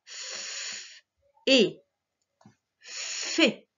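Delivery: "F" as a long hissed fff, then "et", then "fé" blended; sounding out. Shown as a demonstration. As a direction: break "fé" into held "F", pause, "et", pause, whole. Teacher sounds out a syllable.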